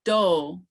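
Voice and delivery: do, pitch rising then falling on one vowel. Shown as a demonstration. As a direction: glide do up and down in the same vowel. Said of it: "the falling tone".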